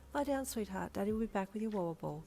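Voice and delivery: put-on soft, gentle soothing to a child; reassuring. A narrator's reading. Mother soothing her sleepy toddler at bedtime, soft and reassuring. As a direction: settle her back down softly. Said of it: "you reassured her".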